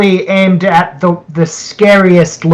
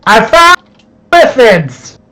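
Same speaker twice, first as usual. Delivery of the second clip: high-pitched yell into the room; acted yell; 6 semitones higher than usual. Player voicing a character backpedalling from Illithids, high yell. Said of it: "and yell into the room".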